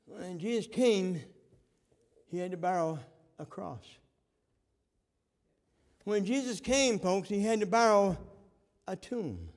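slow, rising preacher's call; building sermon refrain. Acted. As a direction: climb with each repeat of the refrain.

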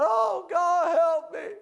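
loud high desperate plea; despairing cry for help; crying out to God. Retold or imitated. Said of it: "cries out to God".

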